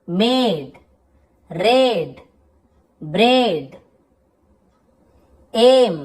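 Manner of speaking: each word said alone with ए held long, as in "गेट"; long ए vowel. Shown as a demonstration. Teacher reading out AI examples, drawn-out ए as in "बेट". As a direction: model words like "रेन", stretching the ए. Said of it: "थोड़ा लंबा ए".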